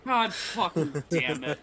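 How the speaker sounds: laughing curse. Real, not acted. Player laughs at bad luck.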